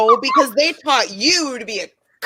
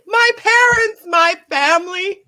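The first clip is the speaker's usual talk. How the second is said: anguished cry; 9 semitones higher, 4 dB louder than usual. Acted. Dramatic cry over burned family.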